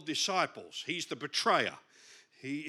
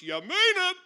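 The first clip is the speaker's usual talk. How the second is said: high, loud burst on the punchline; emphatic shout; 13 semitones higher, 9 dB louder than usual. Retold or imitated. Missionary buddy on praying over food, emphatic shout. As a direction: belt it out, much higher and louder. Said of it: "The first thing you learn".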